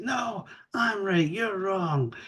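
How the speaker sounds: high, drawn-out mock insistence; stubborn retort. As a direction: stretch it out high in mock defiance. Acted stubborn retort in argument.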